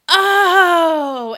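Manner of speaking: loud, high, drawn-out oh; sudden realization. Retold or imitated. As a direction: act out the memory flooding back. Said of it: "it all came flooding back to him".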